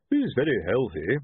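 mildly strained, holding the breath; trying not to breathe. A narrator's reading. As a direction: speak while avoiding a bad smell. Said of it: "while trying not to breathe".

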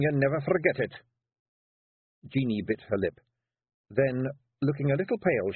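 a wife laying a trap sweetly; Kelda Jeanie. Acted. Feegle dialogue voiced.

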